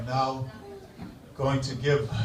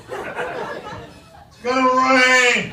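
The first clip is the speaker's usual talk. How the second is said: put-on grumpy Boston accent; 7 semitones higher than usual. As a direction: moan about the week like a loudmouth Bostonian. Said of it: "obviously from Boston".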